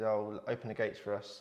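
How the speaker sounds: usual speaking voice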